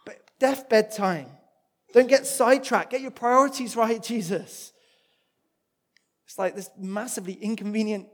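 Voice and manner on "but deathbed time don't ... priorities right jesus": raised, exasperated complaint, higher and louder; imagined fuming. Retold or imitated. Jairus, a desperate father, hurrying Jesus along, exasperated and raised. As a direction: protest at Jesus like a frantic father. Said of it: "could be legitimately fuming".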